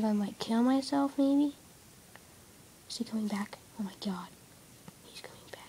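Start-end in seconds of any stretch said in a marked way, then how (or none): 2.9-5.6 scared whisper. Real, not acted. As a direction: whisper in fear. Hiding from the zombie.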